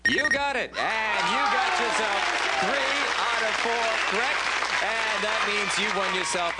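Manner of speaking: high, loud game show cheer; excited cheer. Real, not acted. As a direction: celebrate the win with a big lift. Host cheering a contestant's win.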